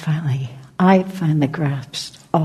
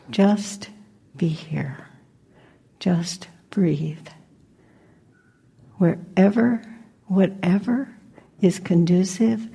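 unhurried meditation guidance, short phrases between long silences; guided meditation. Acted. Teacher guiding listeners to just be here, guided meditation.

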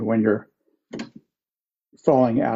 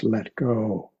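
big mystical voice; 2 semitones lower, 5 dB quieter than usual. Acted. Buddha answers the desperate man on the branch, soft mystical voice. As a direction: intone it quietly like a mysterious voice from above. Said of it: "in this big mystical voice".

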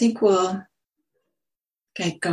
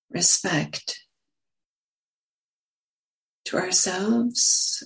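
unhurried meditation guidance with long pauses; lingering on words, leaving long silences between phrases; guided meditation. Acted. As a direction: guide listeners gently into stillness, phrase by phrase.

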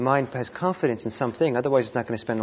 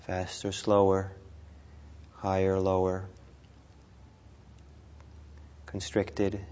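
guided meditation; 3 dB quieter than usual. Real, not acted. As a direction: ease them gently through each breath, unhurried.

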